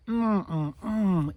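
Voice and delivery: contented mm sounds of eating honey; savoring hum. Acted. Bear enjoying honey, savoring hum. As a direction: hum with pleasure over sweet honey.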